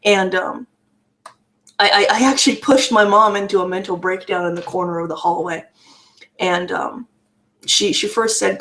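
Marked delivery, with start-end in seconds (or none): none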